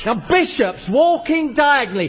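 high, worked-up challenge; sarcastic rant. Acted. Game explainer moving on to bishops, sarcastic. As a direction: press on, slowing into a mocking question.

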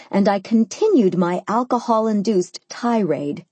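plain reading voice

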